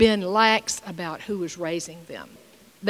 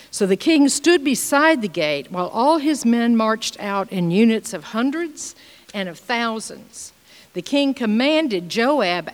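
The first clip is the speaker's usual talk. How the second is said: solemn Bible reading voice; scripture reading; 3 semitones higher, 5 dB louder than usual. Acted. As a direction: proclaim the passage to the congregation. Reads scripture aloud.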